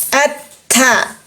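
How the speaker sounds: "At-ta" with a flat back of tongue, light sound; thin TA. Shown as a demonstration. Teacher contrasting istila and istifal, thin "At-ta".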